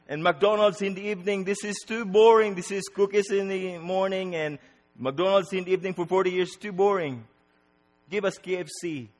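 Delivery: high, petulant mock complaint; whining. Israelites complaining to God about the menu, mock whining. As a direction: whine upward like a fussy child. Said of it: "Whining is like".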